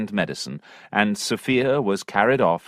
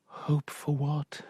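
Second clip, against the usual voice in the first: sad; 5 semitones higher, 11 dB quieter, slower than usual. Acted. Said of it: "said Sophia sadly".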